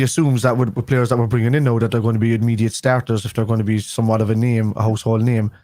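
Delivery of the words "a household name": usual speaking voice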